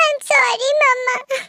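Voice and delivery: slow, sobbing apology; little girl's drawn-out tearful sorry; crying child. Acted. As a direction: apologise to mother through sobs. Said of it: "अब रोने की जरूरत नहीं है".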